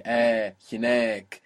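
slow "Eh, hinek", last syllable long and stressed; stress on long vowel. Shown as a demonstration. Teacher showing the long vowel, slow stress on NEK in hinek. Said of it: "that attracts the accent".

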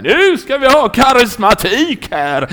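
high, loud, stretched-out holler; rowdy mock shout. Drunken churchgoers demand charismatic worship mid-communion, rowdy mock shout. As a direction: imitate a rowdy worshipper bellowing slowly.

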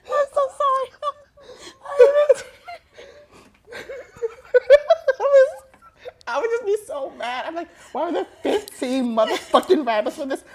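high, loud, helpless laughing through the words; laughing so hard it turns to tears; crying with laughter. Real, not acted. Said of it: "I am crying".

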